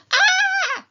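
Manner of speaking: put-on children squealing in a tunnel; gleeful squeal. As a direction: squeal with delight in the dark. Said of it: "they squealed together".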